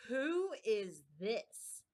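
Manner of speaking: loud, slow, severe demand; loud severe royal question; severe queen. A narrator's reading. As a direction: bark it down at them as a stern queen. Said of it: "the queen said severely".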